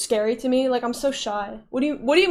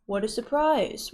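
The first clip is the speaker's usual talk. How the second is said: usual speaking voice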